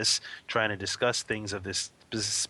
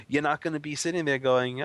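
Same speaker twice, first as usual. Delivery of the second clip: ordinary delivery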